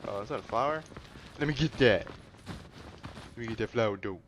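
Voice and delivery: ordinary delivery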